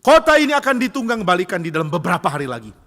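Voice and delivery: acted prophet's booming shout; crying out. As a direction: call doom over a whole city. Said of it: "Yunus berseru".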